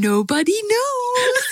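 high, slow, laughing English aside; mock mysterious. Host teasing listeners about the smell, mock mysterious. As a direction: stretch it out teasingly through a laugh.

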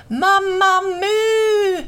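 very high, loud, long-held holler. A narrator's reading. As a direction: holler the name at full stretch. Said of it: "ännu högre".

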